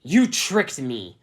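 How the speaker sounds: loud, very slow, each word pressed; angry accusation. Acted. Aragorn accusing Svetlana of tricking him, loud and slow. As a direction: accuse her, hurt and furious.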